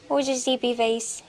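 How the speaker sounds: high, slow cooing pet voice. Real, not acted. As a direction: coo at the sleepy kitten. Talking sweetly to her pets.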